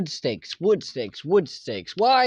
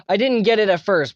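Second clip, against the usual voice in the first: ordinary talk, nothing marked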